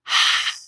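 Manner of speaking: short, forceful breathy exhale; audible sigh out through the mouth; deep sigh. Shown as a demonstration. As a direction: release the held breath in a sigh. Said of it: "with a deep sigh".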